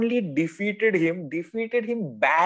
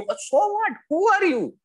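mocking "so what", pitched much higher; scornful. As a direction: toss the question back with high scorn. Scornful retort to the excuse.

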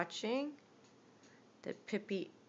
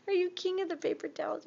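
high teasing; 8 semitones higher, 5 dB louder, much faster than usual. Real, not acted. Teasing the pet.

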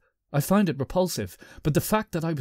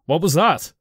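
raised, alarmed question; 4 semitones higher, 6 dB louder than usual. Acted. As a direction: ask it startled and loud. Startled narrator voiced.

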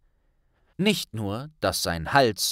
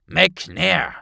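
louder grave name cut-in; serious interruption; 9 semitones higher, 8 dB louder than usual. A narrator's reading. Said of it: "unterbrach ihn der Zaubereiminister mit ernstem Blick".

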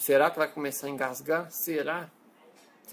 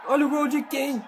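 high, loud goal call; explosive goal commentary; 8 semitones higher, 8 dB louder, slower than usual. Pretend. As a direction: yell the goal like a TV narrator. Calls own goal like commentator.

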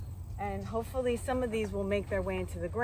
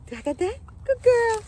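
high praise for the dog; 14 semitones higher, 7 dB louder than usual. Real, not acted. Woman praising her dog for sitting, high loud praise. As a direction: gush over the dog like a proud owner.

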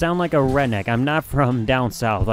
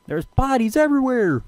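higher, shocked exclamation at the screen; excited shout; 10 semitones higher than usual. Real, not acted. Streamer gasping at the bodies he left behind, excited shout. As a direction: blurt it out in real alarm.

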